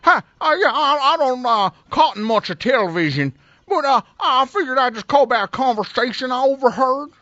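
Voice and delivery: high-pitched mock Southern caller; bad Southern accent. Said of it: "The worst Southern accent I ever heard".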